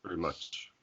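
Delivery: ordinary delivery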